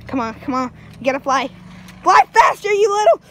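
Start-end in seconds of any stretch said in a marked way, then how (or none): 2.0-3.2 higher, louder yell; exasperated mock-panic shout; frantic urging. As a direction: shout it mid-escape. Urging mount during an escape.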